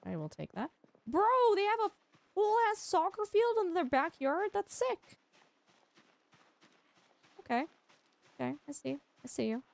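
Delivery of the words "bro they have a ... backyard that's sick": higher, impressed outburst; amazed. Real, not acted. Streamer stumbling on a soccer field in a backyard, amazed and higher.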